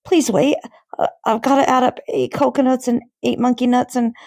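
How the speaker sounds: high, fast panicked pleading; scared pupil scrambling for an answer; flustered boy. Acted. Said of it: "he cried".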